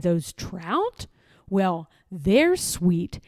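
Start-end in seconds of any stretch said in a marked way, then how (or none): none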